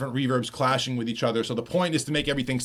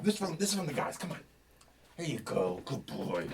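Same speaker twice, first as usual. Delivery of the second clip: soft dog talk; 11 dB quieter than usual. Real, not acted. Talking sweetly to dog.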